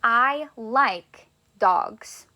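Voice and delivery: very slow, each word drawn out; teacher reading the example slowly; slow model sentence. Shown as a demonstration. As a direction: say it slowly for the class. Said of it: "Our next one that I would like to say is".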